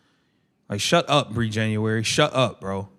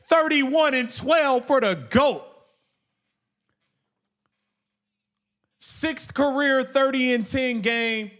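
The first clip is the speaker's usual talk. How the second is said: higher, slow hype read; hyped stats; 7 semitones higher, slower than usual. Real, not acted. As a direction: savour each number. Hyping Caitlin's stat line.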